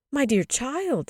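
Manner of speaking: high, loud motherly shock; exclaimed. A narrator's reading. Mother reacts to her child's confession, exclaiming. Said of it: "the mother exclaimed".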